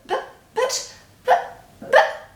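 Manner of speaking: high breathless stammer. A narrator's reading. Shocked mother stammering up at Grandma on the roof. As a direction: sputter the buts in shock. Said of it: "stammered George's mother".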